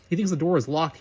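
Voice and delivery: ordinary delivery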